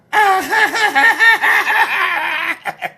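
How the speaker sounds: loud, high, prolonged laugh; big cackle. Real, not acted. Comedian laughing at own joke, loud high cackle. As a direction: burst into a long, high cackle.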